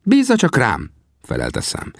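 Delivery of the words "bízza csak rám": very high character voice; confident reply pitched way up; Sam. A narrator's reading. Sam answers in his character voice. (magas, magabiztos figurahang)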